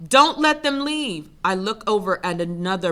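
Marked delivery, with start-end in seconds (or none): 0.1-1.3 loud, high, drawn-out yell; fighters' shout. A narrator's reading. Fighters shouting to each other to block the door, loud shout. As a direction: shout it slowly, pitched well up. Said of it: "As fighters continue to shout".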